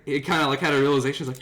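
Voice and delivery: ordinary talk, nothing marked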